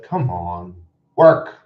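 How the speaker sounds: exasperated command. Real, not acted. Streamer ordering a glitching stream, loud exasperated command. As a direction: order it sharply, at full voice.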